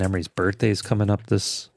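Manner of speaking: ordinary talk, nothing marked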